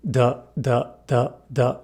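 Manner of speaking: short buzzing d sounds, voice on; voiced d. Shown as a demonstration. Teacher shows the voiced d for contrast: short voiced taps. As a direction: say d, d with the throat humming. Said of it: "How about D?".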